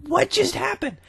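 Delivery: high startled question; squeaky mock bewilderment; confused surprise. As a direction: say it like you can't believe what you just saw. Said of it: "I'm like".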